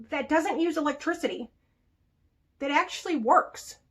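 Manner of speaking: ordinary talk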